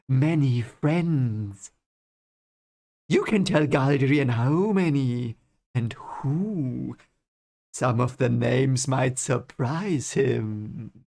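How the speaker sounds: evil count, very slow and drawn out; Barthanes. Acted. Barthanes hinting at secrets to Rand, slow evil-count voice. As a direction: stretch each phrase like a scheming count. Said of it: "imagine him like this evil count".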